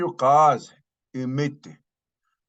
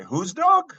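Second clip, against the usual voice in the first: "Whose dog?" high and louder, rising at the end; questioning; 9 semitones higher, 5 dB louder than usual. Acted. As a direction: ask "Whose dog?" in sharp rising disbelief. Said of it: "They say".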